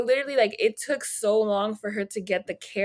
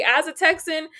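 louder, rising fed-up rant; 6 semitones higher, 9 dB louder than usual. Real, not acted. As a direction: vent your frustration as a Texan. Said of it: "I'm tired of the bullshit too".

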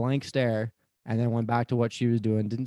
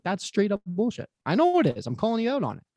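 higher, heated retelling of calling her out; a rising indignant accusation; indignant callout; 8 semitones higher than usual. Retold or imitated. Driver retelling how he confronted the clerk, indignant and higher.

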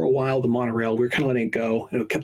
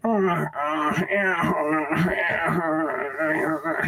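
mock groaning; 4 semitones higher, much slower than usual. Imitating groaning old people.

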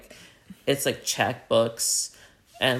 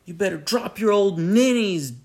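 raised, menacing order at high pitch; higher-pitched tough-guy demand; robber's threat; 7 semitones higher, 3 dB louder than usual. Retold or imitated. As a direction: play the robber threatening old ladies.

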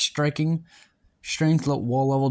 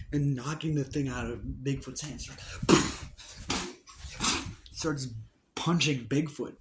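story narration; 6 dB quieter than usual. Acted. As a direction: tell the fight like a story. Narrates the battle scene.

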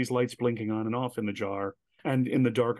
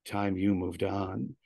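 slow, low warning; understated menace; 4 semitones lower, much slower than usual. Retold or imitated. Armed guitarist to the watching audience, slow mild menace.

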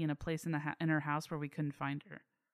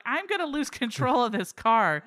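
loud, higher mock-panicked protest; retold angry outburst; 4 semitones higher, 7 dB louder than usual. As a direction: yell it like a driver being hit at the wheel. Retold outburst while being hit driving.

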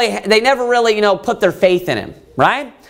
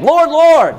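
raised, high begging cry; 6 semitones higher, 4 dB louder than usual. Acted. Preacher acts out those begging the Lord, pleading. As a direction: plead upward for mercy. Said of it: "They're begging him".

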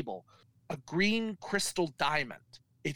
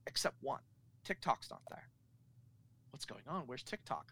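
hushed suspense; 3 semitones lower, 12 dB quieter than usual. A narrator's reading. Suspense in the story.

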